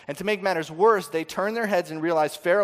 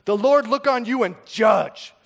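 mock accusing; 7 semitones higher, 8 dB louder, slower than usual. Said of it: "They say to Moses".

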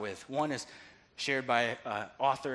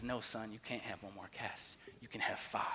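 low, hushed fatherly answer; quiet, measured granting of a wish; softly relenting father; 6 semitones lower, 12 dB quieter than usual. Retold or imitated. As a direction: let the words sink very soft and low. Father relents in faith, retold.

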